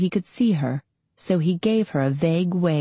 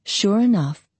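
ordinary delivery, nothing marked

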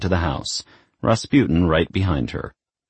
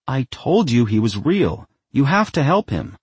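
higher, lightly acted demanding voice; insistent; 7 semitones higher than usual. A narrator's reading. Daughter turning on the doubter for help, mildly insistent. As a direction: press the point, pitch raised.

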